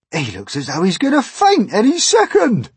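loud, high centipede shout; brash centipede. Acted. Centipede mocking terrified James, brash shout.

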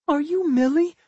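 very high little pup voice; talking pup. A narrator's reading. Pup checks who the human is, high creature voice.

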